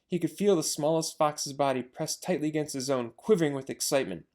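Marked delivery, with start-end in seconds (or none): none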